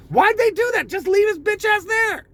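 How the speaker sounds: high-pitched outraged yell. Real, not acted. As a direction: shout the disbelief up high. Outraged rhetorical outburst.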